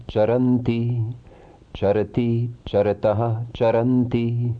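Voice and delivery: even, measured chanting of verb forms; rhythmic recitation. Shown as a demonstration. Teacher reciting Sanskrit verb forms for students, in a measured chant. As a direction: recite each form in a steady rhythm, like a class drill.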